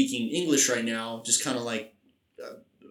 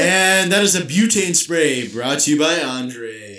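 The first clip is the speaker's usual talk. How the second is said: loud, drawn-out sponsor announcement; slow it down like a show announcer; mock announcer; 7 dB louder, slower than usual. Podcaster announcing a pretend sponsor, big slow announcer voice.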